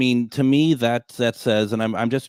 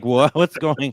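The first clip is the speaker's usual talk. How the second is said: confused surprise; 3 semitones higher, 4 dB louder, slower than usual. Retold or imitated. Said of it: "We're all like".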